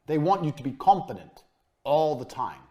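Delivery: ordinary talk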